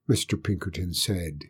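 ordinary delivery, nothing marked

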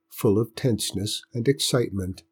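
ordinary delivery

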